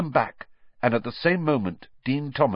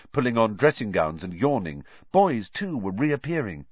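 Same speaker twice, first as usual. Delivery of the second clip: ordinary delivery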